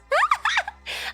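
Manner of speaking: high squealing laugh; shrieking laugh. Real, not acted. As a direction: burst into a shrill laugh.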